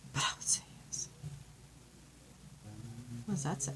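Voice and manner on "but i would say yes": a breathless whisper of "But I would say yes". Real, not acted. Reading the engagement card, whispering she would accept. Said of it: "I would die".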